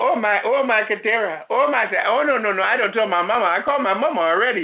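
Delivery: high, wailing imitation of a Colombian accent; mock Colombian accent. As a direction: protest in a hammed-up Colombian accent. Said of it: "said in her best accent".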